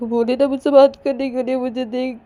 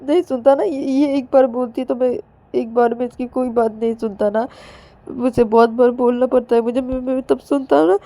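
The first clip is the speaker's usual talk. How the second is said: high, louder crying complaint with stammered repeats; acted tearful pleading; 6 semitones higher, 5 dB louder than usual. Ananya begging mothers to talk Payal round, tearful. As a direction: plead through sobs, whining to the grown-ups. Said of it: "रोए जा रही थी बहुत ही।".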